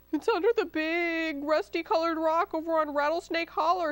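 high, strained fox groan. A narrator's reading. Fox gives up his den with tail caught, groaning. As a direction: groan out the confession. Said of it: "he groaned".

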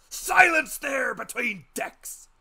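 huge, slow roaring bark; villainous shout. A narrator's reading. Pirate captain roaring at the doctor for silence. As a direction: roar down the whole room. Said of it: "broke out in a villainous little oath".

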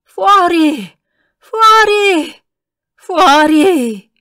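put-on an old man losing his voice in rage; shrill frenzied yell; screeching. A narrator's reading. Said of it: "strillò".

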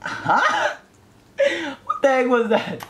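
high startled disbelief; shocked confusion. Real, not acted. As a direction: blurt it out high and stunned. Confused by game event.

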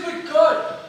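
panicked Saint Peter to God; urgent Peter. Retold or imitated. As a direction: urgently break the news.

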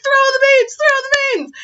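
very high mock-panicked yelling; hammed-up battle cry; frantic urging. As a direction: urge them on in pretend panic. Teachers urging kids amid chaos.

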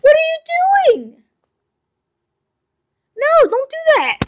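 high loud yell of alarm at the cat; real startled shout. Real, not acted. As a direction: shout to stop her jumping. Cat jumping on desk.

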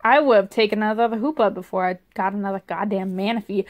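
ordinary talk, nothing marked

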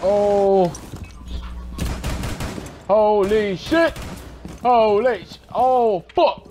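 high panicked cries, drawn out; squealing in-game panic; panicked dying. Real, not acted. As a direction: panic as everything goes wrong.

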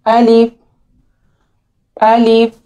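अलिफ, short and clean, no lengthening; short alif. Shown as a demonstration. Quran teacher modelling अलिफ for students. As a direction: model अलिफ crisply for the class. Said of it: "इसको पढ़ेंगे".

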